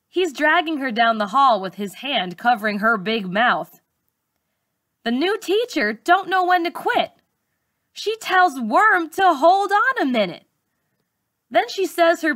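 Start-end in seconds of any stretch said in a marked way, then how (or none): none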